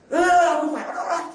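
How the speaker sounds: high, startled "không phải ót ót ót"; mock surprised protest; surprised correction. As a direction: jump up in surprise and correct her. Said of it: "Con nói".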